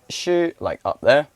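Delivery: "shu" pitched high up; high Mandarin tone. Shown as a demonstration. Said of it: "the other one was shu".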